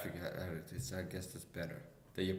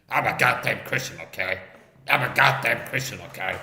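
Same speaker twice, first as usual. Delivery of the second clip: Poon; 2 semitones higher, 11 dB louder than usual. Shown as a demonstration. Comic demonstrating a rival's impression of him, loud and mocking. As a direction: blare it out as an exaggerated mimic. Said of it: "here's my impression of Poon trying to do an impression".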